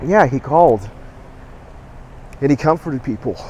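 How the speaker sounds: real emotion pushing through the words; struggling to keep the voice steady; choked up. Real, not acted. Telling viewers how the host comforted callers, choked up. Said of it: "getting a little emotional".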